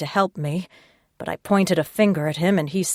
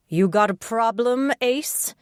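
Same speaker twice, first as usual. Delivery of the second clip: higher, taunting challenge; challenging; 6 semitones higher than usual. A narrator's reading. Detective confronting the smirking smoker, challenging. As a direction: dare him to answer.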